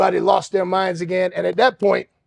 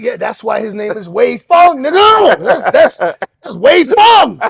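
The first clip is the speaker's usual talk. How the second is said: louder, proud shouted boast, slow at the end; triumphant shout; 5 semitones higher, 9 dB louder, slower than usual. Retold or imitated. Teller boasting to inmates about Wei Feng, loud and proud. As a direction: rub it in their faces.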